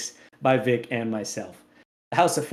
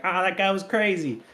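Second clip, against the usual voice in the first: quick mock Italian accent, pitched higher; 5 semitones higher than usual. Old folks' home listener imagined in a playful higher Italian accent. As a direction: toss it off in a light jokey Italian accent. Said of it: "I don't know why I did an a-, Italian accent there".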